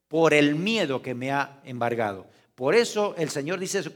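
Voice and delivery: usual speaking voice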